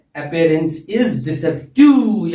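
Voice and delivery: Appearance is deceptive, each word stretched very slowly; slow drilled repeat. Shown as a demonstration. Teacher repeats the proverb for the class, very slow and deliberate. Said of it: "क्या बोलते हैं?".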